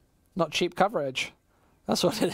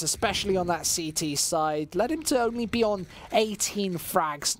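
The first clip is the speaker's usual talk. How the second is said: esports caster call; live play-by-play; 4 semitones higher than usual. Acted. Caster calling the round for stream chat, live play-by-play. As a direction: call each frag to the viewers.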